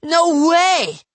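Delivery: very high, loud protest; indignant kid, voice shooting up; emphatic denial. A narrator's reading. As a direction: squeal it in disbelief. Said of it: "he repeated".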